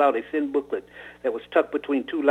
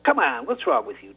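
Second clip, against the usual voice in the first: pushy urging; 8 semitones higher than usual. Acted. Friend pressuring to skip school.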